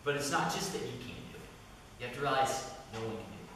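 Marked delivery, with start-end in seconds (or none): none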